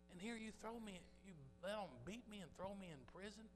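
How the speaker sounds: Paul in jail asking God why this happened, in a high, aggrieved whine; complaining to God. Retold or imitated. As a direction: sound wronged and hurt, like a man who served faithfully and got prison. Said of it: "easy for Paul and Silas to say".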